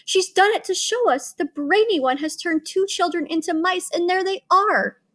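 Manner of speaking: crying out. A narrator's reading. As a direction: shriek it up high in alarm. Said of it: "crying out".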